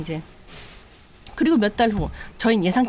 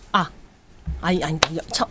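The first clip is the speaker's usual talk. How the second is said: ordinary talk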